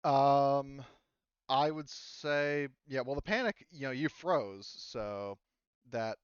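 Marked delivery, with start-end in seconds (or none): none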